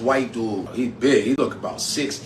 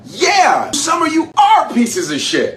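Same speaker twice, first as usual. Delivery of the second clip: high, loud indignant shout; angry outburst; 6 dB louder, slower than usual. Real, not acted. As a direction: shout the accusation straight at the police. Angry address to policemen.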